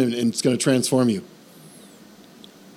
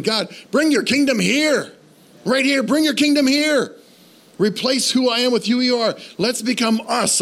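fervent prayer; 10 semitones higher than usual. Acted. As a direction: pour out the longing to God.

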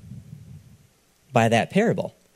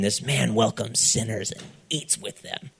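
muttering; 7 dB quieter than usual. Acted. Pharisees muttering.

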